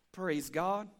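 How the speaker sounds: ordinary talk, nothing marked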